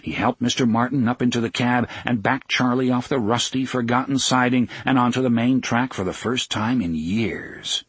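ordinary delivery

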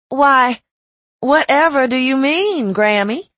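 put-on high, loud Southern belle accent; theatrical Southern accent, rising at the end. A narrator's reading. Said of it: "one of the best Southern accents I had ever heard".

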